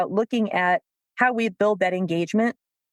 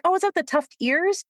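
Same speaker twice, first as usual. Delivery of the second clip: much higher, bright mock curiosity; feigned interest; 9 semitones higher than usual. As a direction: pretend to care only about cats.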